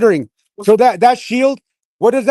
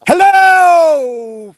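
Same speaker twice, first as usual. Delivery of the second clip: high, loud call-out; shouted greeting; 9 semitones higher, 6 dB louder than usual. Real, not acted. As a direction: hail the crowd loudly. Calling out to protest group.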